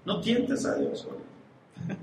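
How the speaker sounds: hushed, slow warning; whispered warning. Acted. Advertencia susurrada al público. (advertencia susurrada y lenta)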